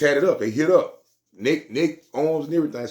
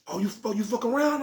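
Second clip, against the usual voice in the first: higher, surprised clerk; voicing the clerk; 12 semitones higher, 4 dB quieter than usual. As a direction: pitch up, mildly surprised. Clerk voiced in story.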